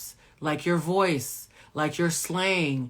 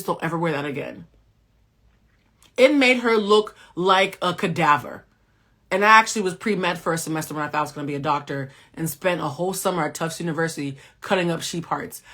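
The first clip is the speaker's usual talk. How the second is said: normal talking voice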